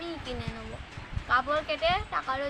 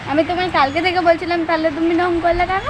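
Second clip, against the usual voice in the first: loud scolding; 3 semitones higher, 11 dB louder than usual. Real, not acted. Wife scolding after prank surprise.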